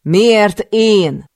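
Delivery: loud, high, furious shout; furious yell. Acted. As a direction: scream it, demanding an answer. Furious captive questioning her captor. (dühös, magas ordítás)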